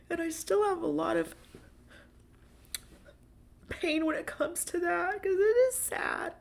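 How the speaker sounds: real sadness about the breakup pushing through; tearful; teary. Real, not acted.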